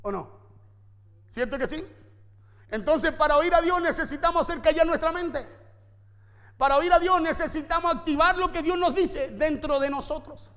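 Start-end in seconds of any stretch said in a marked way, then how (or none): 2.7-10.5 loud, driving sermon delivery; forceful preaching. Acted. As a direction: preach it hard to the congregation.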